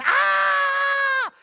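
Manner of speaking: very high, loud shriek; scream in terror. Shown as a demonstration. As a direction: let out a terrified scream. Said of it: "you scream in terror like this".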